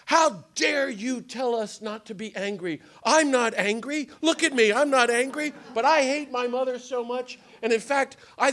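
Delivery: loud, high, indignant outburst; retold angry yelling. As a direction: shout it like an offended missionary. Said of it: "starts yelling".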